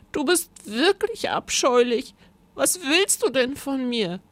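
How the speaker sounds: high, upset little voice; tearful protest. A narrator's reading. Rosa challenging her captor, tearful and high. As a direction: protest through mock sobs. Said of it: "schluchzte Rosa".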